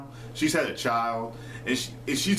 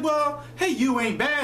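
much higher imitation of a friend protesting; high-voiced protest; slower than usual. Retelling Sarah defending "hey you", high mock protest. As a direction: pitch it way up to mimic her defence.

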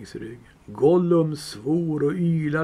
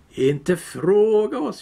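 slow, high howling creature voice; Gollum howling; 6 semitones higher, 4 dB louder, slower than usual. Acted. Gollum refusing Bilbo, high slow howl. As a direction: howl it out, hurt and angry. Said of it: "tjöt Gollum".

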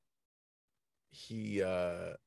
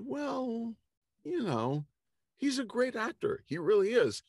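diplomatic hedging; 6 dB louder than usual. Retold or imitated. Buddy carefully praising a difficult actor, high mock-diplomatic hedging. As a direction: imitate a friend hedging, pitched way up.

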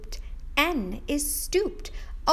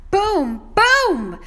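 higher, much louder boom boom; booming refrain; 8 semitones higher, 10 dB louder than usual. Acted. Teacher booming the refrain for the kids. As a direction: let the booms explode.

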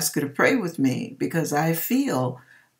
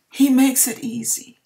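holding back emotion; 7 semitones higher than usual. Real, not acted. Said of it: "I'm trying not to get emotional".

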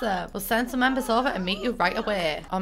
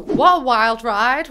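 slow, loud "wild ride" punched; emphatic; 3 semitones higher, 9 dB louder, slower than usual. Real, not acted. Gamer wrapping up the pack emphatically. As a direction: sum it up big.

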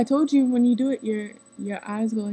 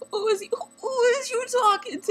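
much higher, sing-song mock sass; hammed-up retort; 13 semitones higher than usual. Quoting the teacher in a high, hammed-up sassy voice. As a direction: play it up like a dramatic diva.

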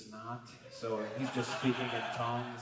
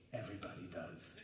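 hushed Wild Man; 3 semitones lower, 10 dB quieter than usual. Retold or imitated.

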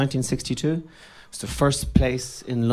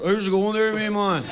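lightly voiced Donegal greeting, a touch higher; Donegal accent; 5 semitones higher than usual. Comedian imitating Donegal locals, Donegal accent. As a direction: mimic a Donegal local saying hello. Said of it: "everyone kind of said".

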